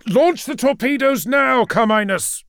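high, loud battle command; shouted order. Acted. Commander to his officer in a space battle, shouted order. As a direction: shout the order across the bridge. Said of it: "Sanguinius shouted".

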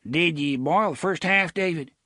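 blustering sheriff voice. Acted. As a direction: play the fussy rural sheriff. Avery voiced as character.

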